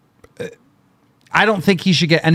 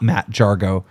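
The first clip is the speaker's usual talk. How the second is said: ordinary talk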